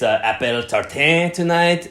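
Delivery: put-on slow, lightly French-accented dessert offer; mock French waiter. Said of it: "he was definitely playing it up a lot".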